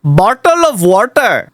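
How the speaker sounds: slow, put-on Indian accent; strong Rs in "Bottle of water", Indian style. Shown as a demonstration. User runs through accents again, Indian accent. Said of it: "do an Indian accent".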